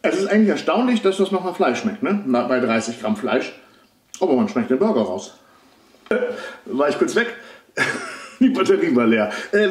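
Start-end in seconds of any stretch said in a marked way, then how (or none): 0.0-2.0 food still in mouth; talking while finishing chewing; speaking after a bite. Real, not acted. Seen 0: Chewed from -12s; at 0.1–0.4 burger held at mouth while talking. 2.0-5.3 chewing while talking; speaking between burger bites; mouth partly full. Real, not acted. Seen 1: Bites burger near -2, talks chewing while holding it, bites again at 3.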